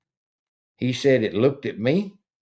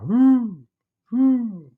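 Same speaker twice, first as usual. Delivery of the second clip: distant owl hoot; 9 semitones higher, 3 dB louder than usual. Retold or imitated. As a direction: imitate an owl hooting far off. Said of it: "it's way off in the distance".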